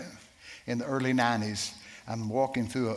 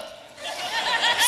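squeaky high giggle; high laughter. Real, not acted. Laughing after the quote.

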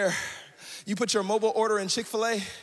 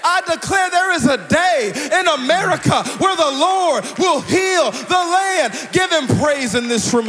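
rising, louder preaching with a sung lilt; full-force preaching; 6 semitones higher, 5 dB louder than usual. Acted. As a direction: preach it out to the room, building. Preacher building to full-force climax.

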